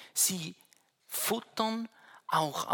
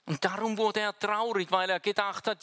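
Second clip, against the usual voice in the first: normal talking voice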